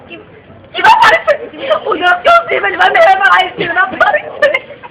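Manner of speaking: mother sobbing to the policeman about the stolen money. Acted. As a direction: wail it out like a distraught mom begging for help. Mother crying, trusting God.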